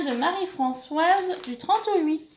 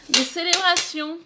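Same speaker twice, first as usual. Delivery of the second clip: ordinary talk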